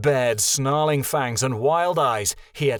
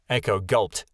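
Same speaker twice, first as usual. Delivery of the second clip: plain reading voice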